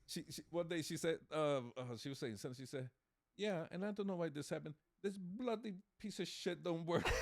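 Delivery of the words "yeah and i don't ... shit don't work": higher, laughing London accent imitation; British accent. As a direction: put on a cheeky London accent, giggling. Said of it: "she speaks like this".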